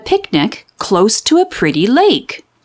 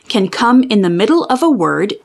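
plain reading voice

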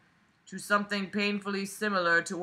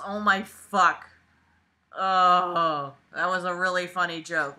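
normal talking voice

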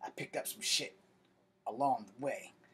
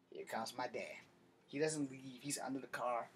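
ordinary delivery

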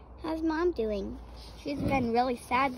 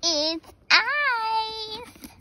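excited; 7 semitones higher than usual. Acted. Excited discovery of ice.

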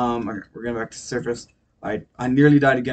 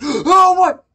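gasping high shriek of shock; gasp, then shriek in disbelief; 9 dB louder than usual. Real, not acted. Suddenly finds himself underwater.

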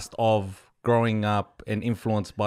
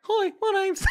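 high put-on tradie voice. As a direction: play a tradie on request, high. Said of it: "do a tradie voice".

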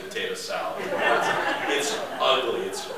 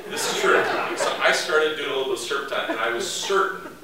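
ordinary talk, nothing marked

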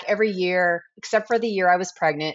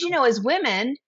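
ordinary delivery

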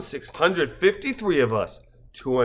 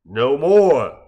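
declared; 6 dB louder than usual. Acted. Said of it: "the speaker declared".

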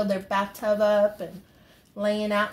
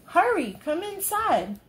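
high, eager hurry up call; a little bunny urging Mama in, much higher; child bunny calling Mama inside excitedly; 9 semitones higher than usual. Acted. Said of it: "said Bunny".

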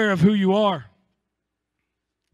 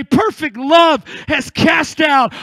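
defiant declaration; 3 semitones higher, 3 dB louder than usual. Acted. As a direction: declare it against your fear. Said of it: "look at that complacency ... and say".

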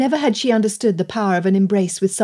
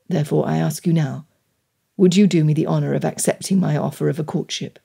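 low, solemn male character voice; earnest gentleman suitor; 4 semitones lower than usual. Acted. Gentleman asking her for a courtship, earnest. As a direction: make a grave, heartfelt vow in a low voice.

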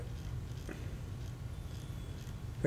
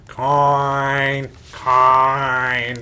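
mummy voice. Retold or imitated. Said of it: "all they would speak is".

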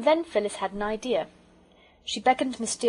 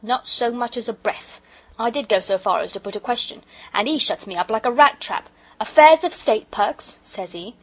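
loud, offended working-man rant; emphatic porter voice, full of grievance; aggrieved porter; 2 semitones higher, 9 dB louder than usual. Acted. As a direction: grumble forcefully as a slighted porter.

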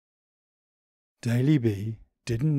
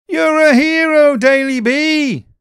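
joyful cheering; 14 dB louder than usual. A narrator's reading. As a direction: cheer him like a hero. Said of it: "they cried".

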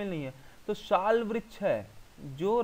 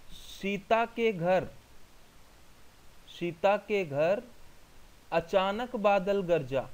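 slow mnemonic dictation; much slower than usual. Shown as a demonstration. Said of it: "वो line को इस तरीके से मैं आपको यहाँ पे बताऊँ".

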